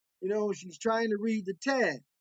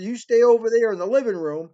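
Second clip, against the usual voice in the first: loud, higher-pitched motherly command; bossy order; 4 semitones higher, 8 dB louder, faster than usual. Retold or imitated. Mother sends Darlene off before a money call, bossy order. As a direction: order her to stay put, loudly.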